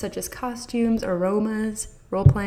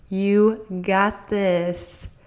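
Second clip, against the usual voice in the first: encouraging; 4 dB louder, much slower than usual. Real, not acted. Encouraging sign-off to parents.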